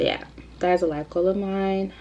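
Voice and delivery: usual speaking voice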